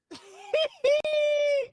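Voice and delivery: loud, high squealing cackle; long high-pitched wheal of real laughter; helpless laughing. Real, not acted. Streamer cracking up over a viral video, squealing laugh. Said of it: "why I'm laughing so hard".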